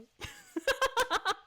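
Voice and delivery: high rippling giggle; delighted giggle; high laugh. Real, not acted. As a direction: dissolve into squeaky laughter. Laughing at kitten.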